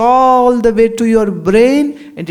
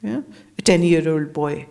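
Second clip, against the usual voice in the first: ordinary talk, nothing marked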